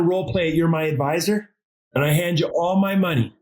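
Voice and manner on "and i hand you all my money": ordinary talk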